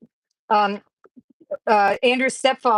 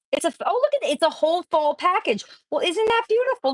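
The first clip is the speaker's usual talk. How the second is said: delighted; 9 semitones higher than usual. Real, not acted. Opening a gift package.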